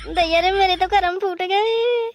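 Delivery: slow, rising theatrical lament; hammed-up wail. Bride seeing the mud house, hammed-up wailing. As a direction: stretch it into a mock wail. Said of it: "भोंपू ना बजाओ".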